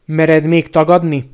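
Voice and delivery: loud, slow furious challenge; outraged and forceful; angry accusation. A narrator's reading. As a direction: demand it loudly, drawing out the words.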